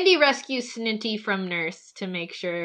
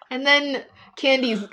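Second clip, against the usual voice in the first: ordinary talk, nothing marked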